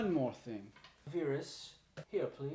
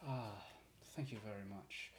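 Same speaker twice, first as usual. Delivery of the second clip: ordinary delivery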